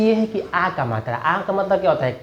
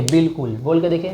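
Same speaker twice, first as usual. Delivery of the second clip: usual speaking voice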